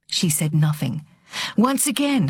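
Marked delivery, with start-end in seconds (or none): none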